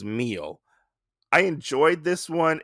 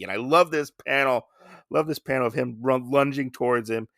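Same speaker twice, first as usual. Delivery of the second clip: ordinary talk, nothing marked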